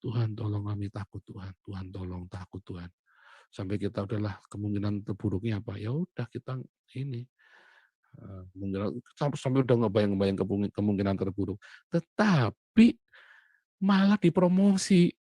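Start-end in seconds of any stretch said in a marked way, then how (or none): 0.0-2.9 fading prayer. Retold or imitated. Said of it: "apalagi bilang". 11.9-15.1 amazed. Real, not acted. Surprising turn in the story.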